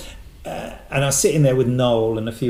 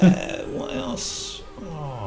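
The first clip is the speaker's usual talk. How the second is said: breathy musing; 3 semitones higher, 5 dB quieter, much slower than usual. Real, not acted. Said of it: "musing aloud".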